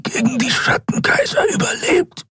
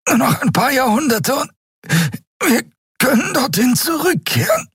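high, strangled gasps, climbing higher; panting through clenched teeth; 13 semitones higher than usual. A narrator's reading. Choked captive insists his world lives, gasping. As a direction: force each phrase out while being choked. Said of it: "Mit zusammengebissenen Zähnen keuchte er".

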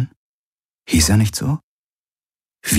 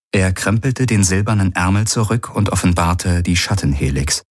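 ordinary delivery

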